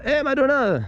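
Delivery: high teasing shout; mock call. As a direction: hail her like a fan. Calls toward [name]'s old house.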